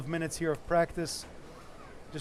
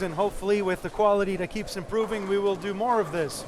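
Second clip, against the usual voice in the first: loud upbeat stream host; announcer voice; 4 semitones higher, 5 dB louder than usual. Acted. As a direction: project like a live broadcast announcer. Stream host addresses viewers.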